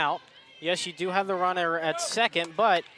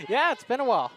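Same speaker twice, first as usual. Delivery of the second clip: laughing; 5 semitones higher than usual. Real, not acted. Old broadcast partner greeted, laughing.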